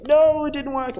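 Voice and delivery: high, exasperated no; frustrated at a machine. Real, not acted. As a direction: groan at the broken software. Software failing, frustrated.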